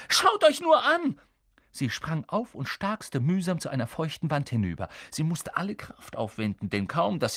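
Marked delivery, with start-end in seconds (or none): 0.1-1.2 high, loud shriek; girl shrieking at the tangled boys. Acted. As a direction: scream it at them. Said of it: "kreischte Hermine".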